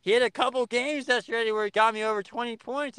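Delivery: ordinary delivery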